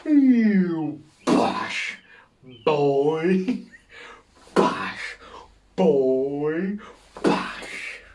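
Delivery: loud mock splat noises, yelled out; bungee bounce noises. To co-host, mimicking a bungee jumper smashing down, loud mock thuds. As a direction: yell the thuds of a body hitting the ground.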